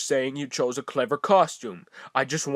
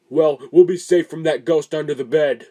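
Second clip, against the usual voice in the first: ordinary talk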